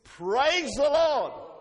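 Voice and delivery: high, loud, drawn-out call; rousing praise. Shown as a demonstration. Preacher having the congregation practise praise, loud and drawn out. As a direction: lead the room with a long PRAISE. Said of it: "Let's have a practice".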